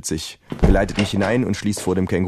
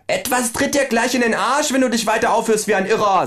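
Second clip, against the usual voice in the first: much higher, aggressive kangaroo; higher menacing kangaroo; threatening kangaroo. Acted. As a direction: snap a threat at the doctor.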